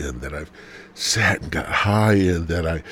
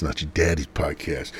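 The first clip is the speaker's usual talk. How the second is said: ordinary talk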